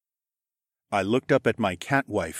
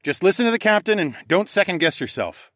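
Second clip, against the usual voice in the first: raised high voice over the wind; loud, pitched-up shout; 9 semitones higher, 4 dB louder than usual. A narrator's reading. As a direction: call out reassurance through a gale. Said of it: "I shouted over the wind".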